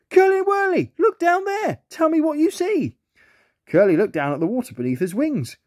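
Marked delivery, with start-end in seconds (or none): 0.1-3.0 very high squeaky lobster voice; excited lobster. A narrator's reading. Lobster telling the planes to look down, very high and excited. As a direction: squeak out the big discovery.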